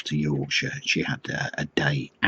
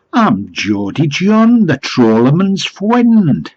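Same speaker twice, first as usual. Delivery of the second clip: slow, loud, high-pitched Geordie; mock Geordie accent; 10 semitones higher, 7 dB louder, slower than usual. Fish seller introduces himself at the door, broad mock Geordie. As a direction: announce yourself in a hammed-up Geordie accent. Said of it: "I don't think it was a genuine accent".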